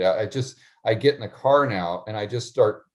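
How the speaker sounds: normal talking voice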